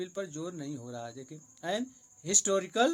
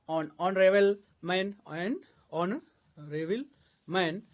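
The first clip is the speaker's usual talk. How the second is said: slow teaching demo; 4 dB louder, much slower than usual. Shown as a demonstration. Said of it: "teacher models the phrase".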